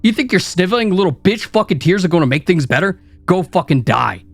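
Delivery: from a sneering taunt to a high screaming rant; building to a scream. Acted. Inner critic rant builds to screaming.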